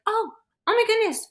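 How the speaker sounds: imitating mom's flustered apology; mom apologizing. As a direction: gush out sorry like a flustered mom. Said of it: "right away, she's like".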